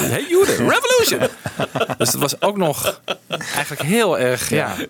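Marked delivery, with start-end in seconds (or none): 0.1-1.2 very high laughing slogan; mock rally cry. Host jokingly voicing the window slogan as a call to arms. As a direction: shout it like a call to revolt. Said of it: "oproep aan de Joden".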